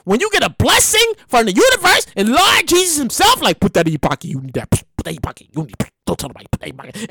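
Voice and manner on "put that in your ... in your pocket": low, hushed confidential urging; hushed, low and conspiratorial; secretive advice. Acted. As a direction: slip the advice over quietly, under your voice. Voices Jesus giving secret advice.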